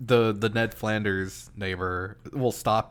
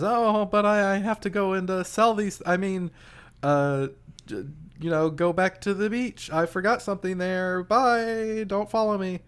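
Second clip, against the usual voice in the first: higher pitched hurried excuses; flustered excuse; 10 semitones higher than usual. Retold or imitated. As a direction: play a nervous girl dodging, voice up high. Mizuki voiced making excuses.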